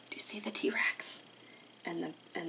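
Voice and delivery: put-on soft secret whisper to the kids; hushed whisper. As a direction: whisper it, as if hiding from the T-Rex. Suspense reading to children.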